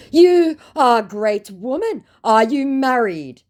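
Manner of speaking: high, loud and slow; hammed-up lovestruck scammer; mock scammer. Scammer proposing to her in a high slow mock voice. Voicing scammer declaring love.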